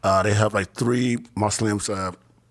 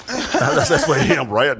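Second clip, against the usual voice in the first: laughing through the words at his own mistake; real laugh breaking into the line; 5 semitones higher, 5 dB louder, much slower than usual. Real, not acted. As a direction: chuckle it out in disbelief.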